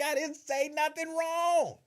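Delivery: high, laughing plea of innocence; mock innocent protest. Answering the corporate lawyer, mock innocent high protest. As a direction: squeak out a fake-innocent denial. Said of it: "I'd be like".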